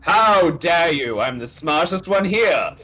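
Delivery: high, loud, hammed-up outrage; indignant outburst. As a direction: bellow it out, drawing out the first words. Brutus acted, outraged at the insult.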